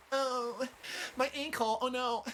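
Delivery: high, soft whimper; imitated crying. Fallen girl hurt her ankle, mock crying. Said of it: "I realize that she's crying".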